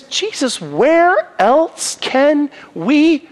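lightly acted disciple answering Jesus, high and intense; Peter's devotion. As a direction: voice Peter pouring out his loyalty. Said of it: "He says".

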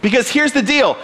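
ordinary talk

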